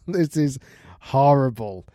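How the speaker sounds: slow, laughing disbelief; appalled but cracking up; laughing in horror. Real, not acted. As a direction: say it through a real laugh. Cracking up at the story.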